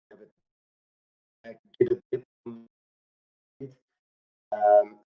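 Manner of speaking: choppy audio cutting in and out; broken-up internet call; glitchy robotic call. Real, not acted. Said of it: "The audio is kinda breaking up".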